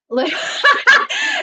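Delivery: high burst of laughter. Real, not acted. Mother recalling homemade family pajamas, laughing.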